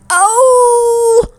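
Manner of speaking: high, loud moaning oh; loud pained cry. A narrator's reading. Ava in the bush cries out from toothache, loud. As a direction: cry out from hiding in pain. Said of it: "shook the bush".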